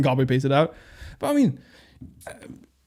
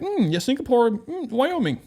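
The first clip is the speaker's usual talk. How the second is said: clueless namer; 10 semitones higher, slower than usual. Retold or imitated. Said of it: "be like".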